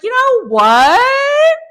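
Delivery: very high, loud, slow drawn-out cry; furious outburst. Retold or imitated. Vlogger relives her fury at the ending, high loud drawn-out cry. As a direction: let the rage burst out. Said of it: "pissed me off so fucking bad".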